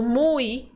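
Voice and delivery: muy said with a clear final ee glide; muy ending in ee. Shown as a demonstration. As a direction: end muy on ee. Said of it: "por exemplo".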